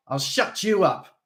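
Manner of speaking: menacing snarl. A narrator's reading. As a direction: snarl the threat. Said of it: "Steve snarled".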